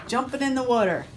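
plain reading voice

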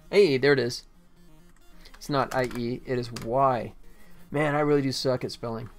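ordinary delivery

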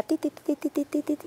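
high, soft mock robotic beeping "ti, ti, ti"; playful high-pitched mimicked beeps; bionic woman sound effect. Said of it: "me sentía yo como la mujer biónica".

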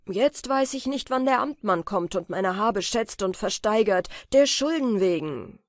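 lautes, erregtes Hadern mit dem Schicksal; zorniges Klagen. Acted. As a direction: schimpft den Kleinen laut aus. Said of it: "Da geriet er in Zorn und Eifer". (Peter klagt das Glasmännlein laut und zornig an)

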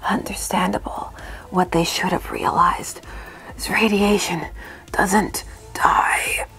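slow, hushed, mock-profound villain line; slow theatrical villain menace. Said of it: "keep having the character repeat this phrase".

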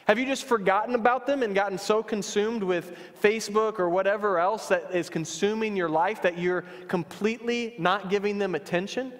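normal talking voice

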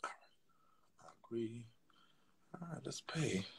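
breathy whispered aside; whispered to herself while paying. Real, not acted. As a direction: murmur it to yourself. Whispering at the computer.

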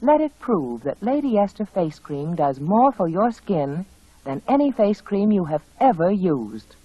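ordinary talk

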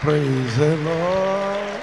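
loud, slow call of praise; drawn-out praise. Real, not acted. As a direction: stretch each word to the crowd.

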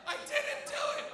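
very high, loud mock wail; imitated accused girl. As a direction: squeal your denial as a cornered girl.

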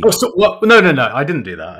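loud, high, rapid backpedalling; hasty denial. Real, not acted. Host takes back his violent story, loud hasty protest. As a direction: blurt out a flustered denial.

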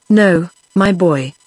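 ordinary delivery

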